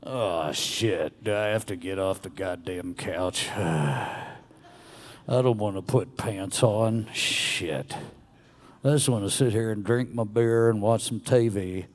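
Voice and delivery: low, weary Southern grumble; grumbling Southern slob. Acted. Voicing Grumblefuck, who won't leave the couch, grumbling. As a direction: groan it out like a couch-bound grouch. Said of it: "Grumblefuck sounds like this".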